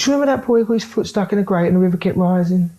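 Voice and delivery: Charlie Bronson impression. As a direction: tell the grim tale in the mate's high caricature voice. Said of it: "He goes".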